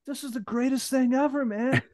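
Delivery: high-pitched, giddy gushing; hammed-up squeaky delight; thrilled. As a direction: imitate a doctor beside himself with glee. Thrilled doctor acted out.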